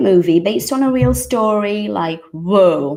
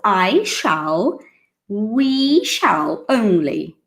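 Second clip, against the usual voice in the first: slow and deliberate; 2 semitones higher, much slower than usual. Shown as a demonstration. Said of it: "supposed to use it only with I".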